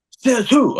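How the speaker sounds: high-pitched put-on defiance; mock challenge. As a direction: mimic an indignant challenger way up high. Retold quarrel, man challenges him.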